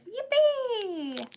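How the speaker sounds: put-on squeaky high baby-doll cheer; excited baby doll. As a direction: voice the tiny doll shouting for joy. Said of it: "who's just so excited to be alive".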